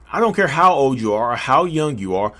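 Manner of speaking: ordinary delivery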